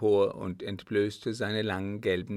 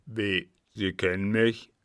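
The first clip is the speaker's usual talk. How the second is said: lightly voiced captain, taken aback; suspicious captain; 4 dB louder than usual. A narrator's reading. Captain learns the girl knows him, mildly suspicious. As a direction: question her as the wary captain.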